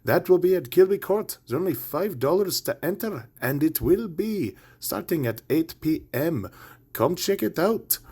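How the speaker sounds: cheesy Irish brogue read of the show listings; terrible Irish accent. Acted. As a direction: play a bad stage Irishman plugging the gigs. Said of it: "that was my terrible Irish accent".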